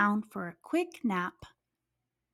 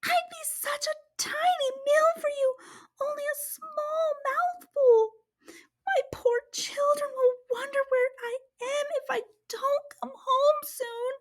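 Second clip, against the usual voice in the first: crying mouse plea; 13 semitones higher than usual. Acted. Mouse pleading with the hungry lion, high and tearful. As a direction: beg for your life in a tiny crying voice. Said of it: "cried the mouse".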